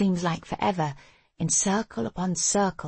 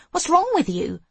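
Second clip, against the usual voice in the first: high, loud, angry challenge; sharp exasperated demand, raised voice; angry hiss; 12 semitones higher, 6 dB louder than usual. A narrator's reading. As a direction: hurl the question out, pitch leaping up. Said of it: "I hiss".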